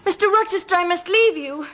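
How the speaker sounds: high, pained and pleading; anguished. Acted. Jane telling Rochester she must leave him, anguished. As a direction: force out the farewell through distress. Jane declaring love and leaving, distressed.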